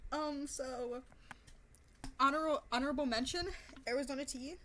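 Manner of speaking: normal talking voice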